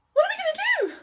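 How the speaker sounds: very high, loud panic; high-pitched helpless panic; panicked. Acted. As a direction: squeal it in alarm. Panic as boyfriend approaches.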